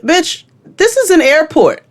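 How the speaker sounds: high, loud-ish outraged friend; retold scolding. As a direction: re-enact telling off a stranger in public. Acted friend scolding a stranger.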